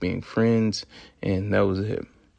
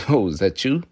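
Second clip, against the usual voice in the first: louder mocking question; scornful disbelief; 2 semitones higher, 5 dB louder than usual. Shown as a demonstration. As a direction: ask it as if you would never. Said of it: "I would never".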